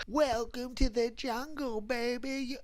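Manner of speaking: high, slow, soft creepy voice; hushed high sing-song taunt; creepy prank caller. Retold or imitated. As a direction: lure them in, high and eerie. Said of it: "I'd go".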